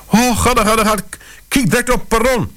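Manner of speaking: high frantic gasping urging; gulping in panic. A narrator's reading. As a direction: urge him on, high and breathless. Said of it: "gulpte ze tegen mij". (hoog en hijgerig van paniek)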